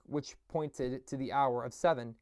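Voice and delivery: ordinary delivery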